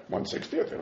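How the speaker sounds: "One sixtieth" breathed in a whisper, no voice; soft voiceless "One sixtieth" under the breath. Shown as a demonstration. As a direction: drop to a hushed aside on "One sixtieth". Quoting the Gemara, then repeating it.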